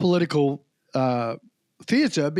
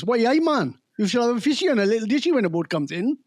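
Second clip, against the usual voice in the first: put-on quick, broad Geordie accent; 3 semitones higher, faster than usual. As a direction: rattle it off in thick Geordie. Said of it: "That's Geordie accent".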